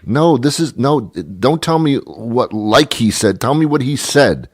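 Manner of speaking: high-pitched scolding building to breaking point; exasperated, building outburst. Acted. Rant builds toward telling him off.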